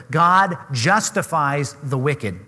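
"God" stressed, every word spaced out slowly; slow, weighty "God justifies the wicked"; slow emphatic quote. Shown as a demonstration. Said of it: "That's a critically important phrase".

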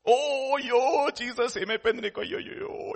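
imitating women lamenting aloud; mock lamenting. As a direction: wail theatrically like a mourner. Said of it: "mourning and lamenting".

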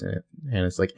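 normal talking voice

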